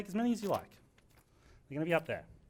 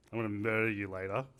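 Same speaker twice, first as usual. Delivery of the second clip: creepy; 7 semitones lower than usual. Retold or imitated. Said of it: "not a good smile either".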